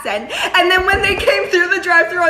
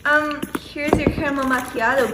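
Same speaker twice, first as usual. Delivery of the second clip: flirty; 5 dB quieter than usual. Shown as a demonstration. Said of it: "this is exactly how I gave him his coffee".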